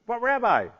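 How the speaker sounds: high, falling plea; pleading protest. Acted. Disciples pressing their reluctant rabbi, pleading. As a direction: plead like an insistent pupil, pitched high.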